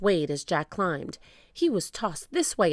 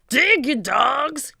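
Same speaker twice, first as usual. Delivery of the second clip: slow, loud, raised pirate bellow; shouted order; 8 semitones higher, 7 dB louder, slower than usual. A narrator's reading. Captain driving his men to dig, shouting. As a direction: draw out the shouted command. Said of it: "cried Cap'n Bones".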